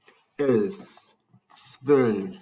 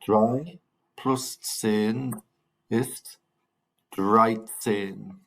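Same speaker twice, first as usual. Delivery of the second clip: slow teaching demo; much slower than usual. Shown as a demonstration. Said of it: "Say it, guys".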